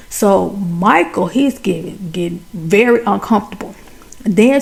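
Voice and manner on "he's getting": plain reading voice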